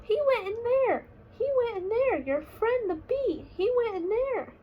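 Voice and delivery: put-on high, bright-eyed child voice pointing the way; eager little cub. Small bear tells his dad where the bee flew, high and eager. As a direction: tell dad excitedly like a small kid.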